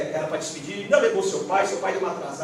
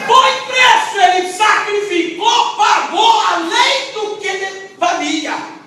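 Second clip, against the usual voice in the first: full-force preaching shout, high and loud; 12 semitones higher, 10 dB louder, much slower than usual. Real, not acted. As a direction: preach it at the top of your lungs, slowing down.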